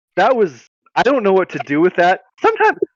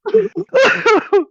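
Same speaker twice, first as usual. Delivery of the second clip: a high helpless burst of laughter; laughing hard. Real, not acted. Cohost cracks up at the demon story, helpless high laugh. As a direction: lose it completely, laughing high. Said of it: "the fact that you're laughing so hard".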